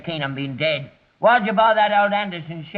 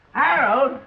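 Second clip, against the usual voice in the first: ordinary delivery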